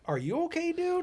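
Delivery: high worried question; concerned. Retold or imitated. Tom asks Frank.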